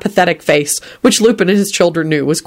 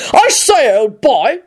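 bellowing; 7 semitones higher, slower than usual. A narrator's reading. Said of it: "Richard bellowed".

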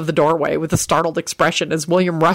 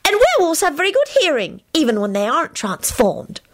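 high, know-it-all explanation; smug; 11 semitones higher than usual. A narrator's reading. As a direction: lecture with self-satisfaction. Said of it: "replied in a smug voice".

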